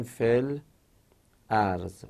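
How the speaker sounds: "fel", a break, then ارض starting on a clear "a"; second hamza sounded. Shown as a demonstration. Teacher showing the root hamza kept, ارض started afresh after فل.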